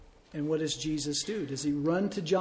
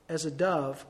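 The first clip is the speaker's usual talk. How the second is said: usual speaking voice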